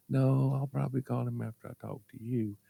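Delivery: softly voiced mother; mother on phone. Mother answering her child on the phone, subdued mother voice. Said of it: "What you doing, Mama?".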